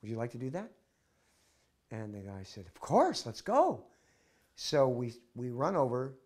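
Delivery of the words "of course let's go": eager yes. Retold or imitated. Soldier eagerly accepts invitation.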